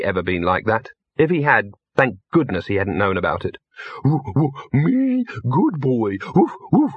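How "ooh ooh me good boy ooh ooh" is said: high, slow dog-voiced woofs; happy dog barking. A narrator's reading. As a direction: bark the words like a simple, happy dog. Gaspode imagines being a happy dog.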